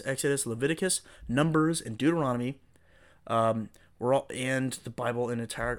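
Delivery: ordinary talk, nothing marked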